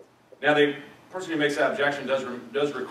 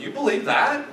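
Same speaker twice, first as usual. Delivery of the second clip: incredulous, much higher; friend's mock disbelief, pitched far higher; 12 semitones higher, 4 dB louder than usual. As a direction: shoot the question up in disbelief. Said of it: "friend comes along and goes".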